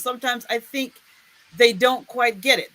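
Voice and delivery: ordinary talk, nothing marked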